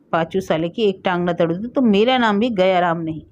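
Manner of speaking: ordinary delivery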